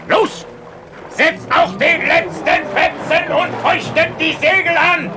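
high panicked shout; frantic shouting. Acted. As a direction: yell the orders in panic. Urgent order to flee.

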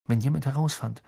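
ordinary delivery

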